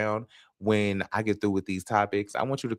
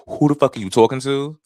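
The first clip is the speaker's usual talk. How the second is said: raised, loud New York challenge; rough New York accent; 6 semitones higher, 8 dB louder than usual. Acted. Quoting how they sound when upset, rough New York accent. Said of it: "really rough New York accent".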